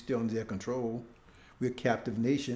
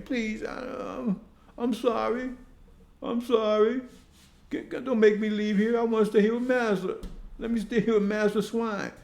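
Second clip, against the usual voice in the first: grovel for mercy, pitch raised and pushed; mock begging; 8 semitones higher than usual. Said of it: "Then he started begging".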